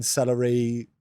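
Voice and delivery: ordinary delivery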